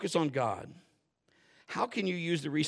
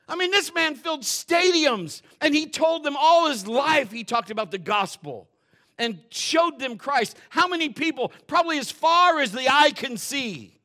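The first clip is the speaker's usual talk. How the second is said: louder, higher fiery preaching; full-force preaching; 10 semitones higher, 10 dB louder than usual. Acted. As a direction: build it up for the crowd.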